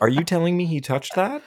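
high, disbelieving question; can't believe what you saw; incredulous. Real, not acted. Disbelief at the goal credit.

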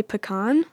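"pecan" said puh-KAHN; own pronunciation. Shown as a demonstration. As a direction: stress the second syllable of "pecan", kahn. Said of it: "I'm fairly certain I say".

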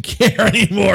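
normal talking voice

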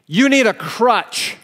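high, loud and slow mocking yell; imitating a heckler's loud mocking yell; retold jeer. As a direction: sneer it loudly down the hall. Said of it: "he yelled at me down the hallway".

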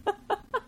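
giggle. Real, not acted. Laughing at sad story.

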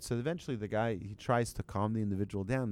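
ordinary talk, nothing marked

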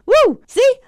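high loud whoop; excited whoop. Real, not acted. Host fired up about a clue, loud high whoop. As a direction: let out a joyful cheer. Cheers at her own excitement.

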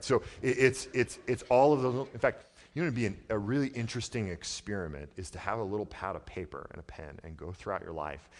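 loud, high, urgent preaching; impassioned sermon voice; full-force preaching. Acted. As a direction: preach it out to the back row. Preacher's raised, energetic delivery.

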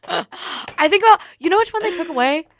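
high, loud, excited squeal; excited gasp. Real, not acted. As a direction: burst out with excitement. Sudden excited memory.

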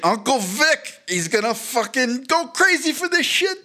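high, excited mock Italian accent; sort-of Italian accent. Agent's uncle hyped over a script, mock Italian accent. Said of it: "that was sort of Italian".

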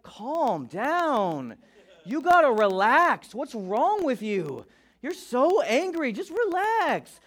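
a hint of a scolding wife, drawn out; wife calming him. Wife beside him in the car, slow soothing scold. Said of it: "she's like".

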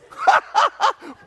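shouted high cackle of glee; rowing buddy erupts in shouting laughter; loud high burst of laughter. Retold or imitated.

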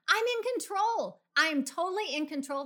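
high, emphatic declaration; loud proclamation. Shown as a demonstration. Coach showing listeners how to tell their driver, loud and emphatic. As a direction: belt it out for the person beside you. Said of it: "Say it as loud as you can".

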